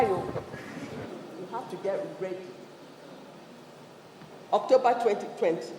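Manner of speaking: ordinary delivery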